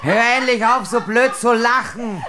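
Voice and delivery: loud, high exasperated order; angry scolding. Acted. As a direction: shout down the giggler. Queen scolds the laughing girl.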